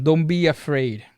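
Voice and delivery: ordinary talk, nothing marked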